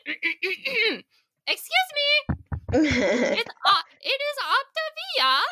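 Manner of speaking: high, prissy correcting voice; fussy pronunciation police. Retold or imitated. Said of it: "be like".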